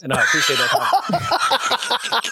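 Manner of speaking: slow, mock-grateful through a laugh; dry slow sarcasm with a laugh; sarcastic thanks. Real, not acted. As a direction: drag it out, laughing, to mock the joke.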